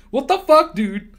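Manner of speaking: ordinary delivery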